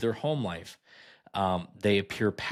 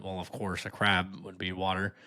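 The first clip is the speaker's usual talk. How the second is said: ordinary talk, nothing marked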